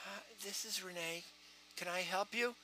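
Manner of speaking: very soft, small phone voice; subdued callee. Shown as a demonstration. Renee answering a call, soft and subdued. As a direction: answer the phone barely above a murmur. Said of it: "It has to do with the voices".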